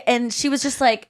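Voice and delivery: usual speaking voice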